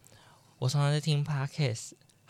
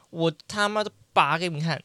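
defiant; 5 semitones higher, 5 dB louder, faster than usual. Real, not acted. Defiant decision to pull tooth.

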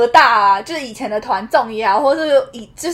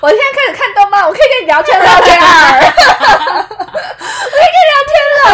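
laughing; 11 semitones higher, 5 dB louder, faster than usual. Real, not acted. Gushing to idol [name].

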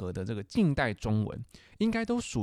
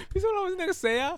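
laughing; faster than usual. Real, not acted. Asks while still laughing.

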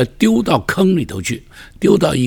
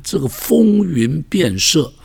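ordinary talk, nothing marked

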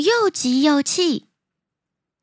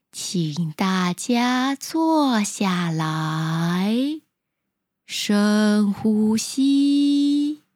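slow, soft yoga teacher voice; 3 semitones lower, much slower than usual. Acted. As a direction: guide them gently into calm. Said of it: "声音柔和而且缓慢".

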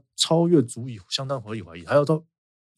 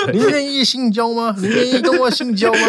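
laughing mock question; 10 semitones higher than usual. Host mocking a foreigner's misunderstanding, high laughing mock voice. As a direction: ham up a clueless foreigner while cracking up.

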